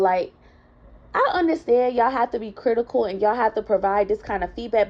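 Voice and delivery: ordinary talk, nothing marked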